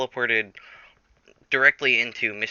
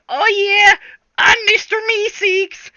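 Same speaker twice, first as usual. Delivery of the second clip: very high, loud, slow squeaky cry; Mister Meeseeks; 10 dB louder, slower than usual. Acted. Jack as Meeseeks squeaks his catchphrase to fans. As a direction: bounce up in a squeaky cartoon shout. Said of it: "And he's like".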